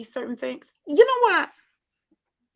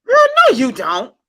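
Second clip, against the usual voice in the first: loud disbelief; 10 dB louder than usual. Real, not acted. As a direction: burst out in friendly denial. Loud disbelief at being called country.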